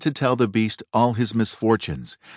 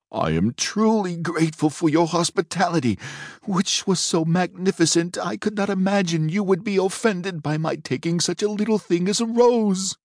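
frightened father pleading with a furious beast; terrified plea; 10 semitones higher than usual. Acted. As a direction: beg for mercy on your knees, voice lifted in fear.

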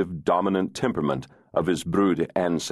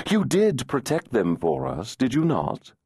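teasing fairy voice; 7 semitones higher than usual. Acted. Adam questions Searson.

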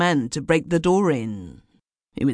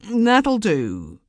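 mildly uneasy, higher-pitched start; a touch of unease, pitched up; 8 semitones higher, 3 dB louder than usual. A narrator's reading. Uneasy listener cuts into the ghost story, mildly uneasy. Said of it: "said an uneasy voice".

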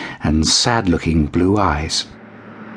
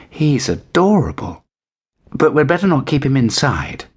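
put-on higher, fond girl voice; little girl; 7 semitones higher than usual. A narrator's reading. Girl cooing over the kitten. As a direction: coo over the kitten like a child.

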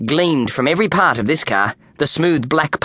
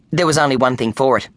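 ordinary delivery, nothing marked

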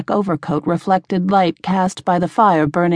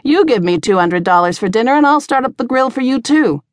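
higher-pitched, mildly gruff comeback; gruff retort; 6 semitones higher, 3 dB louder than usual. A narrator's reading. Tavern keeper to a regular, mildly gruff retort. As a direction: put him in his place, a touch gruffly. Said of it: "customary gruffness back in her voice".

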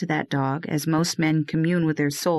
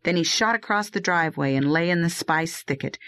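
usual speaking voice

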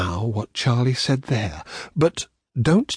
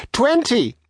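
shouted; 10 semitones higher than usual. Acted. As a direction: call out the end of the count. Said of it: "Charlie shouted".

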